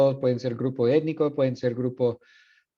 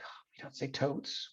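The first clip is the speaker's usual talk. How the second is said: hushed, voiceless aside; imitating a cringing student under the breath; cringing mock whisper; 14 dB quieter than usual. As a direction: whisper it like an embarrassed listener.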